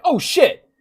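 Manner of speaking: sudden high, loud yelp of surprise; shocked shout that settles into awe; startled outcry. Retold or imitated. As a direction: jump at what's inside the cave. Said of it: "I walked in the front door and I'm like".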